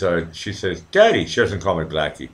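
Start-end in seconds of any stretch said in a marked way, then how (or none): 0.9-1.2 high, louder "Daddy"; mock daughter calling her dad; imitating daughter. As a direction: call out like a little daughter.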